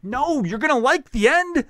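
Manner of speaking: high, loud, pleading with the crowd; exasperated plea. Retold or imitated. Comic begging the audience to listen to the end, high and loud. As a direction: beg them to keep listening, voice up high.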